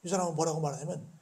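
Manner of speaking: ordinary talk, nothing marked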